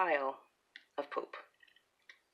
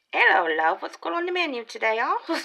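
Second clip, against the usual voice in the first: retold British accent, high and loud; son's cheeky mock British accent ordering lunch; 13 semitones higher, 11 dB louder than usual. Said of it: "in a complete British accent".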